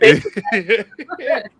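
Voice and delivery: high, loud burst of laughter; laughing hard. Real, not acted. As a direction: crack up helplessly. Laughing at the impression.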